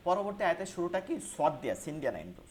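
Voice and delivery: ordinary talk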